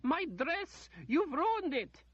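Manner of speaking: very high, loud outraged shriek; the lady shrieking about her sleeve. A narrator's reading. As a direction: shriek over the ruined dress. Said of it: "Lady Ordith shrieked".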